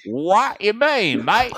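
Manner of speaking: high, slow mock Australian accent; jokey confused Australian accent. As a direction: drawl it like a baffled Aussie. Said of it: "Australian accent".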